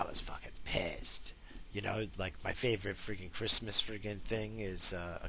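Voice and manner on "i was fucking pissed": whisper. Real, not acted. Whispered after the mock yelling.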